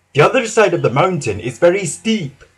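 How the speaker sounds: usual speaking voice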